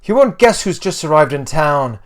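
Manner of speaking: bustling Irish mum calling out; weird Irish accent. A narrator's reading. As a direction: announce big news in a put-on Irish accent. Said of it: "a weird Irish accent".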